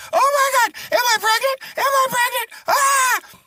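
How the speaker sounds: very high, hammed-up squealing; excited woman. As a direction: squeal and shriek at the news. Patient excited at apparent pregnancy news.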